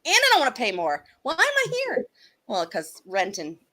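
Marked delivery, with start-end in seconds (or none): 0.1-2.1 higher-pitched mock complaint; playfully indignant whine; exasperated self-quote. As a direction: protest it, rising high on the question. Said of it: "I'm like".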